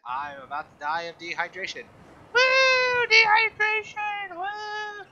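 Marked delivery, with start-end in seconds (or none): 2.3-5.1 high shrieked woo, ironic cheer; mock celebration. Gamer cheering his own dehydration with a loud mock whoop. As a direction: cheer your own doom like a party.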